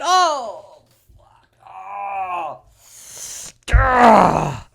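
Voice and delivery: mock anguish. Opponents despair over the deficit, mock anguish. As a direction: wail and groan in hammed-up despair.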